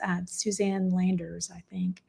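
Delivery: soft whispered aside; whispering. Real, not acted. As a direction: drop to a whisper. Trailing aside about her own name.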